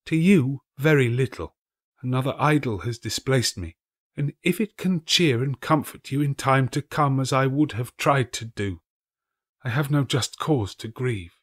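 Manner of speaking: lightly voiced grieving girl; young girl's voice. A narrator's reading. The girl tells Scrooge another idol replaced her, sadly.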